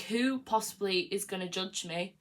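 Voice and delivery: ordinary talk, nothing marked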